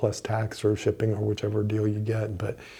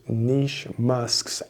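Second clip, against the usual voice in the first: slow, deliberate "niche musks"; careful articulation. Real, not acted. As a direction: sound out a tongue-twister carefully. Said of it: "That's a hard one to say".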